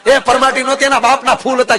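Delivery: high, loud build to the punchline; storyteller's rising comic climax; punchline shout. Acted. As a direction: drive each phrase harder until the last word. Punchline built up loudly.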